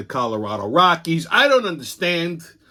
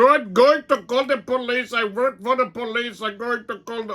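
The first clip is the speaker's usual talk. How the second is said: higher whiny voice threatening to call police; mock drunk threat; 10 semitones higher than usual. Drunk man whines a threat at the teller, higher and petulant. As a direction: make the threat sound pathetic.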